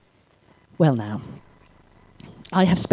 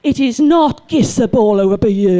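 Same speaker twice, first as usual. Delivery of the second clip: louder, exasperated teacher snapping "you moron"; exasperated correction; 4 semitones higher, 5 dB louder than usual. Retold or imitated. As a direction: scold the boy's grammar from far away. Correcting a boy's grammar across pitch.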